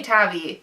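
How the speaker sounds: "Tavi" as TAV-ee; short-A pronunciation. Shown as a demonstration. Reader settling on her own version, "Tavi" as TAV-ee. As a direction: say "Tavi" to rhyme with "savvy". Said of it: "I say Tavi".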